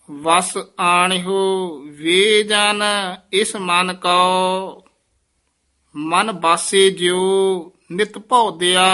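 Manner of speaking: slow gurbani recitation. Acted. Teacher reciting the verse to students, slow devotional chant. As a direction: intone each word slowly and reverently.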